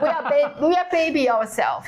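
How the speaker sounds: laughing through a mock reply; high, giggling comeback to a critic. Laughing reply to a rude comment. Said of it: "We think".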